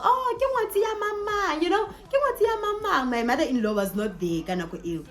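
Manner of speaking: high, sing-song voicing of the old ladies; high teasing auntie voice; mock old ladies. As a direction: tease like gossiping aunties.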